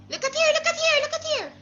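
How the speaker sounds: very high, rapid singsong repetition; urgent attention call. Real, not acted. Urging distracted child to look.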